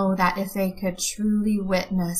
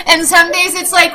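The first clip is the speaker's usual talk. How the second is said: laughing; 9 semitones higher, 8 dB louder than usual. Real, not acted. Laughing at her own joke.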